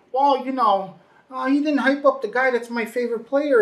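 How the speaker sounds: high, loud mock fan grievance; complaining fan. Podcaster mimicking a fan justifying hating Joe Buck, mock complaint. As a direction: push the pitch way up, imitating an aggrieved fan.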